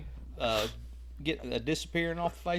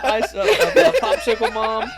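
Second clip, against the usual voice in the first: mock pleading kid; 9 semitones higher, 14 dB louder than usual. Continues teasing as begging child.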